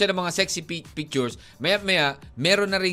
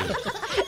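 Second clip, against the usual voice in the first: high squeaky laugh; 5 dB quieter than usual. Real, not acted. Laughs at own joke.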